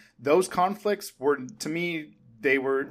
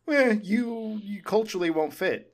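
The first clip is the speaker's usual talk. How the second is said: higher-pitched, hedging imitation of vague objection; mock vague dismissal; 7 semitones higher than usual. Critic imitating the family's objection to Rachel, mock vague dismissal. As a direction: imitate a wishy-washy brush-off. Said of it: "They just say vaguely".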